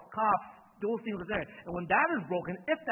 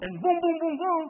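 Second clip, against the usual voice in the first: imitated hits; 11 semitones higher, 10 dB louder than usual. Imitating the hits that killed him.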